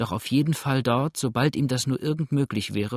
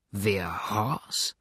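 hushed, puzzled question; taken aback, almost under the breath; astonished; 3 semitones lower, 4 dB quieter than usual. A narrator's reading. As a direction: ask in quiet amazement. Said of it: "fragte Tom erstaunt".

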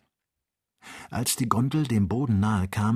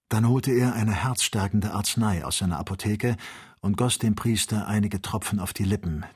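ordinary delivery

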